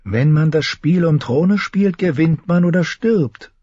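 high, slow, voiced woman's part; high mocking queen, not truly whispered; whispering queen. A narrator's reading. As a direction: pitch it well up for a taunting queen, drawn out at first. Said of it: "flüsterte sie".

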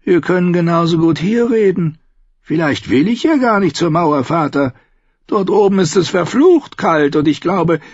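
Tyrion's voice. Acted.

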